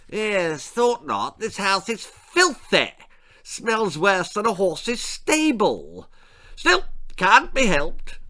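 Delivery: a haughty lord sniffing at the house; pompous lord. Acted. Lord Percy's voice in story.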